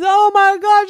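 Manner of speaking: very high, loud panicked cry; scared shriek. Acted. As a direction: scream sliding too fast. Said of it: "he was so scared".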